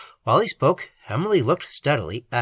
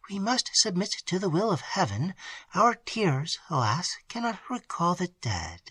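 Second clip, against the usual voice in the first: put-on soft, higher young lady's voice fading to a whisper; gentle consolation; 5 semitones higher, 6 dB quieter than usual. A narrator's reading. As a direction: console her softly. Emily consoles grieving maid.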